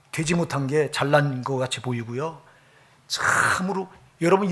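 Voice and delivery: usual speaking voice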